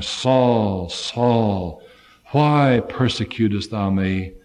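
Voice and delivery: low, solemn heavenly call, slow on the last line. Acted. Heaven calling Saul on the Damascus road, low and solemn. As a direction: call down from above, grave and slow.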